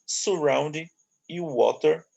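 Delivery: usual speaking voice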